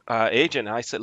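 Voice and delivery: usual speaking voice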